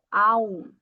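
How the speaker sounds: aum as a nasal diphthong. Shown as a demonstration. Teacher modeling the nasal diphthong aum for students. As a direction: hum the aum through the nose. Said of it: "um ditongo nasal".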